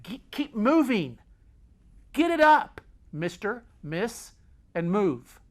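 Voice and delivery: high, loud sergeant's orders; barked drill command; master sergeant. Acted. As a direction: order the troops to get moving. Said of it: "Just like a master sergeant".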